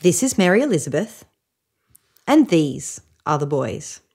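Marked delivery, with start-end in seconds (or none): none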